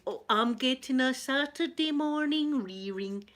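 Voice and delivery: normal talking voice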